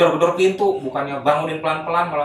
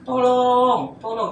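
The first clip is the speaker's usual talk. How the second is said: high, drawn-out cry for help; long wailing call; very loud scream; 11 semitones higher, 4 dB louder, much slower than usual. Retold or imitated. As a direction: re-enact a loud yell for help. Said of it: "Itu keras banget".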